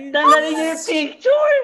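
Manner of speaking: loud, high shouted demand. Real, not acted. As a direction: yell it at the group. Repeated demand to remove the picture.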